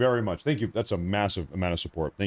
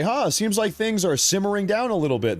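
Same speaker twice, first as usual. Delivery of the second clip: much higher, airy mock relief; mock relieved musing; 12 semitones higher, 3 dB louder than usual. Quoting himself to his chat, much higher mock relief. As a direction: toss it off like a hopeful sigh.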